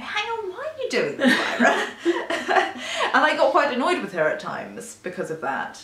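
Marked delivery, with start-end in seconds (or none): 0.0-1.9 high, laughing mock protest; exasperated aside. Listener to the audiobook heroine, laughing exasperation. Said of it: "You go". 1.9-3.1 high, bright burst of laughter; amused laugh. Real, not acted. Friend laughing after mocking the heroine, amused laugh.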